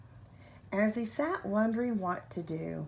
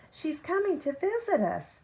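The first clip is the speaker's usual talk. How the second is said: put-on higher, eager child calling out the news; excited shout; 8 semitones higher than usual. A narrator's reading. Child telling family Grandma's visit, excited shout. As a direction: call out the big news, bright and high. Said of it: "shouted the other".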